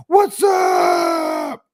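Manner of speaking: shouted high "What's up"; over-the-top hello. Shown as a demonstration. Greeting family at home with a shouted high hello. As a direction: burst in way too hyped. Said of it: "it's not gonna be like".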